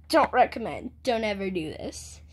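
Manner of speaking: ordinary talk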